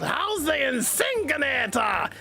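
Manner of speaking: slow, hammed-up mad scientist; Doofenshmirtz voice. Streamer answers a chat question as a cartoon villain, slow. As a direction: ham up the evil inventor. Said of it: "I start talking like, uh, Doofenshmirtz".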